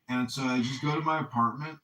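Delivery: ordinary delivery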